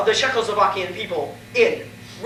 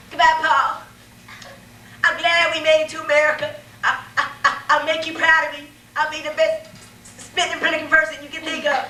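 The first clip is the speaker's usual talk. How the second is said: earnest farewell; 7 semitones higher than usual. Acted. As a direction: make a big heartfelt promise. Son's tearful farewell.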